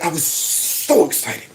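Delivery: hammed-up fighting back tears; mock choked-up. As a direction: drag it out like fake crying. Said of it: "But he's like".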